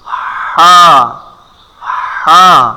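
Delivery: হা as a strong breathy pharyngeal h; long friction before the vowel in হা; mid-throat ha. Shown as a demonstration. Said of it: "এই কণ্ঠনালীর ঠিক মধ্যখান থেকে".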